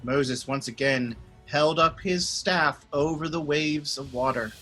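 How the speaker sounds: plain reading voice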